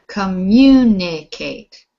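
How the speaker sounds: "Communicate" slowly, MU long and loud; stress on second syllable. Shown as a demonstration. Teacher correcting a student who said fourth, "Communicate" stressed on MU. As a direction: stretch and push MU in "Communicate". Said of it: "Not the fourth".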